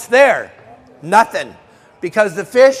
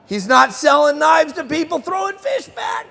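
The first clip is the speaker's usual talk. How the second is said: much higher, pushing the words out like a shout; heated rant; 8 semitones higher than usual. Real, not acted. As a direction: rail against the catch-and-release crowd. Ranting about catch and release.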